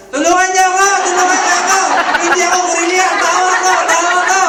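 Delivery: high, full-volume cries for help; frantic retold screaming; panicked shouting. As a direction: shout desperately, acting out the terror. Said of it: "At nagsisigaw siya".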